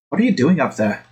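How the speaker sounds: ordinary talk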